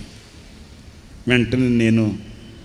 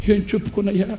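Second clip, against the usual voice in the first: put-on crying old father; 10 semitones higher than usual. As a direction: lament it like a broken old man near tears. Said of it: "ఆ తండ్రి ఏడుస్తూ అంటాడు".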